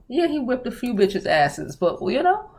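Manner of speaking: normal talking voice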